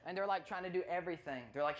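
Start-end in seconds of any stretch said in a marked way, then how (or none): none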